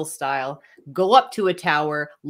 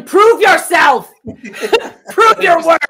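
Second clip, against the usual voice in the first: big booming shout from the tower; yelling; 10 semitones higher, 9 dB louder, slower than usual. Pretend. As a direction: bellow the challenge down. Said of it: "I would be yelling".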